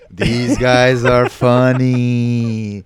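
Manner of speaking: very slow, drawn-out hypnotic command; mock hypnotist. Magician hypnotizing a comedy crowd, mock hypnotic drone. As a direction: stretch each word like a stage hypnotist.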